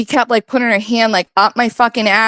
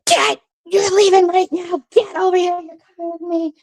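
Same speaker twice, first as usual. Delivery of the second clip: imitated screaming mom; 8 semitones higher than usual. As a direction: imitate a furious mom ordering him out. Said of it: "what she was screaming about".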